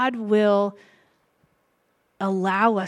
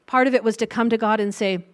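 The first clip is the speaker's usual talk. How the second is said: usual speaking voice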